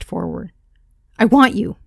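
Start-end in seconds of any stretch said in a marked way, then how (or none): 1.2-1.8 blurted loud and high; nervous blurt, way too loud. Acted. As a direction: overshoot it, blurt it out. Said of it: "I didn't mean to sound so loud".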